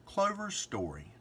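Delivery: ordinary delivery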